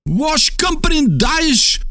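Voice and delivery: very high, slow, strained shout. A narrator's reading. Geographer yelling at the silent native, very slow and high. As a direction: yell it out to be understood. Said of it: "закричал Паганель так громко".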